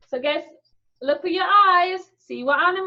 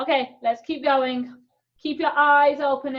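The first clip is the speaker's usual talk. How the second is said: normal talking voice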